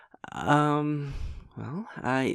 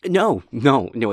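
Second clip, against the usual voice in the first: loud hasty no; 11 dB louder, slower than usual. Acted. Insisting it's fine.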